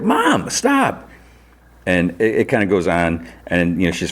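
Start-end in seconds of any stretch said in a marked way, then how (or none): 0.0-1.1 very high, stretched-out imitation of a mortified son; retold protest, squeal it without shouting; mock exasperated whine. Son stopping mom's embarrassing talk, high exasperated imitation.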